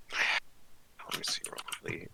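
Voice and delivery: slow, unvoiced whisper to self; whispered aside. Real, not acted. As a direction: whisper it while reading the sheet. Muttering aside while checking spells.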